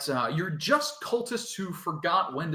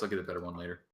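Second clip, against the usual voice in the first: usual speaking voice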